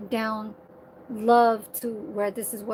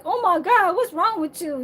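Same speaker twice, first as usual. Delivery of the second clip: higher, louder mock outrage; mock exasperation; 7 semitones higher, 5 dB louder than usual. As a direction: act out an exasperated complaint. Voices an exasperated quote she rejects.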